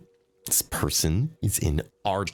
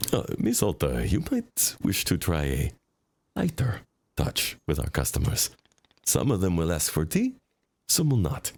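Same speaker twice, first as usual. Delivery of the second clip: put-on lower, calm, formal accented voice; low-voiced foreign advisor; 4 semitones lower than usual. Advisor urging Miss Alta to go easier, low and calm. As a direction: gently correct the boss.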